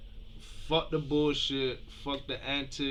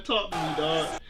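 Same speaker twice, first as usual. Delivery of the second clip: normal talking voice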